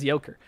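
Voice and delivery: "Joker" said as "Yoker", with a y; his pronunciation. Retold or imitated. Explaining to chat how he says it, "Joker" as "Yoker". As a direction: swap the j of "Joker" for a y. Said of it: "That's just how he says it".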